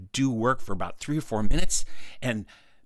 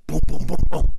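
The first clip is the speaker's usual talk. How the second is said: knocking imitation; 3 semitones higher, 13 dB louder than usual. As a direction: imitate knocks with forceful booms. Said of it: "So I knocked on the door".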